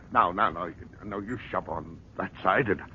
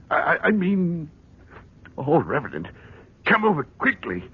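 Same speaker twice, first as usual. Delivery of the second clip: frantic; 8 semitones higher than usual. Acted. Frantic call to the reverend.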